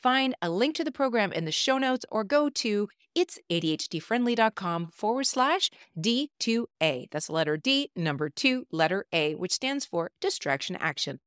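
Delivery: upbeat promo read. Acted. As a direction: pitch it like a radio spot. Recorded promo for her program.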